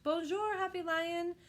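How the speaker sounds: a mild mock shout of welcome; crowd shouting. A narrator's reading. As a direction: call down from balconies. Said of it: "shouted at last".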